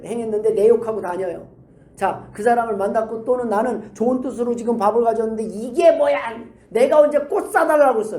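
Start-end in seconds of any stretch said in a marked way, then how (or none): 5.4-8.2 higher, louder acted scolding; indignant, raised-voice mock outburst; irritated complaint. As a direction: snap at an unwanted gift. Acted ungrateful person snapping at gift.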